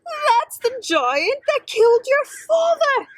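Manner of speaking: high, loud cry of horror; horrified cry. Acted. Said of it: "cried out in horror".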